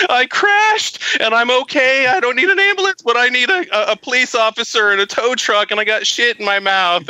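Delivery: high, put-on sobbing victim voice; hammed-up weepy phone call; fake crying. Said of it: "I'm gonna turn on the waterworks".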